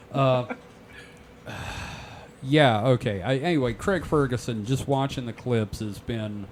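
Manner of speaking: usual speaking voice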